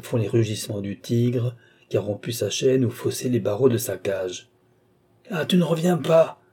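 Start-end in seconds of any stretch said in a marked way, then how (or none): 5.3-6.4 high-pitched angry cry; enraged yelling. A narrator's reading. Altotas yells at the one not returning, enraged. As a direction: yell it after the deserter. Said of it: "hurlait Altotas".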